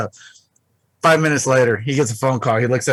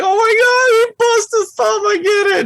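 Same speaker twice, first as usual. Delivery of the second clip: shocked outburst; 5 dB louder than usual. Retold or imitated. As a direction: jump way up high and loud, stunned. Acts his own shocked reaction.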